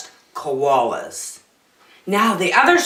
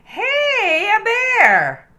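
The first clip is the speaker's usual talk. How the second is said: much higher, excited cry of a discovering explorer; high, loud explorer's shout; 5 dB louder, much slower than usual. Acted. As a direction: call it out like a thrilled sailor spotting a bear. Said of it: "Captain Cook said".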